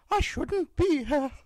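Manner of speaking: put-on English accent. Continuing the put-on voice.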